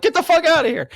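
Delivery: high, loud incredulous yell; astonished high-pitched outburst; retold disbelief. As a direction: relive your amazement at a coincidence. Retold disbelief at matching colors.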